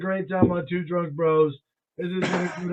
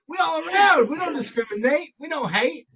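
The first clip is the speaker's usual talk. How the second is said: higher-pitched shout-out; hyped-up welcome; 7 semitones higher than usual. Real, not acted. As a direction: cheer the listeners on, high.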